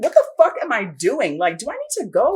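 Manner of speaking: high, loud, exasperated yelling; retelling losing it at her partner over a podcast, high furious yell; furious outburst. Retold or imitated. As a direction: blow up in disbelief at him. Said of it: "I lost my shit. I was like".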